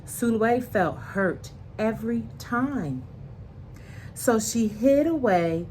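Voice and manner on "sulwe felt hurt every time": plain reading voice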